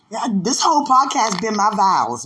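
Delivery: normal talking voice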